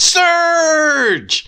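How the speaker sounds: high, drawn-out yell on SURGE; mock-heroic stretched shout; hammed-up shout. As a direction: belt out the name, long and loud. Repeats the mock-yelled name.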